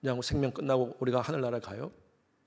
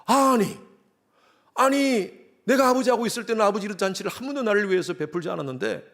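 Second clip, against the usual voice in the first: indignant complaint, higher and louder; older son protesting to father, higher and indignant; angry protest; 7 semitones higher, 4 dB louder than usual. Acted.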